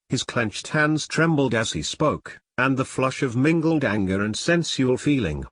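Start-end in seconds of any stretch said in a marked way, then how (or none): none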